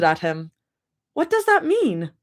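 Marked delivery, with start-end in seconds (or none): 1.1-2.1 indignant. Acted. Rumi squinting, offended.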